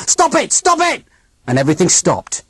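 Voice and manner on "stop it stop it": screaming. A narrator's reading. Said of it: "I screamed out".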